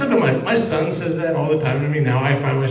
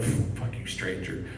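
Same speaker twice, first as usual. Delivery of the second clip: whispered dog voice; 6 semitones lower, 11 dB quieter, slower than usual. Pretend. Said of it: "even the dog is like".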